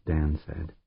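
usual speaking voice

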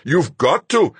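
high, loud, sharp order; snapping. A narrator's reading. Fuchs insists the pilot stay conscious, snapping. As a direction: snap it at the flagging pilot. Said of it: "he snapped".

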